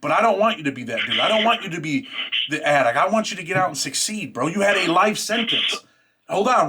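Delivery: usual speaking voice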